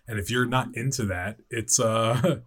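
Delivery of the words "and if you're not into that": ordinary talk, nothing marked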